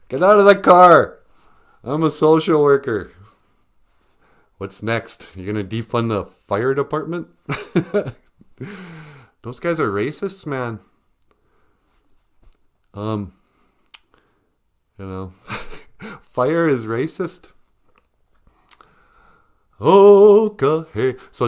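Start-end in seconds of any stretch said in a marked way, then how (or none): 0.1-3.1 high, loud bark at the driver; mock cop order. Mock traffic stop. 19.8-21.1 big booming okay; shouted transition; loud segment opener. Acted.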